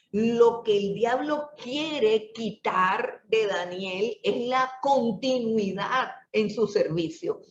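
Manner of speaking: normal talking voice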